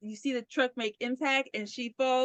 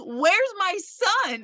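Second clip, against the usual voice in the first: slow, higher pleading whine; whiny crybaby; 7 semitones higher, much slower than usual. Retold or imitated. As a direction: drag it out like a crybaby. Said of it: "crybaby's like".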